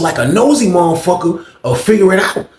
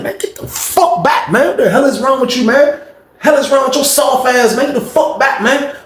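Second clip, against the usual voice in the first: yelling at Rob; 10 semitones higher than usual. Retold or imitated. Pushing Rob back by the neck, high angry yell. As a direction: shove him off with the words.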